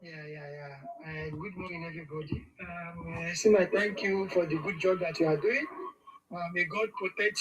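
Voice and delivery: faint voice far from the mic; very low, distant phone voice; barely audible. Real, not acted. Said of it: "Your voice is very low".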